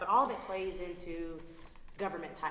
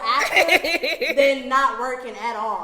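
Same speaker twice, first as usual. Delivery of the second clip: laughing; 4 semitones higher, 14 dB louder, slower than usual. Real, not acted. Laughing while making her point.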